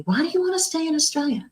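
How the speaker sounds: quick, official question from the manager; manager's question. Retold or imitated. Immigration manager questioning her.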